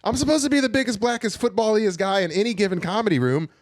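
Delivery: high, loud squeaky mock complaint; high mock rant. Comic at open mics, ranting high and loud about the British rival. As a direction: squeal it like a jealous whiner. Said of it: "I'm like".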